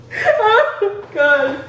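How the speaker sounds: high, loud, breaking with laughter; cracking up, pitch way up; real laughing fit. Real, not acted. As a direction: gasp it out through real laughter. Recovering from laughing at partner.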